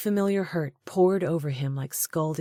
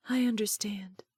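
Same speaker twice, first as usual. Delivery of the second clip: soft breathy whisper; 5 semitones higher, 3 dB quieter than usual. A narrator's reading. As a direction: barely breathe the words. She answers him softly.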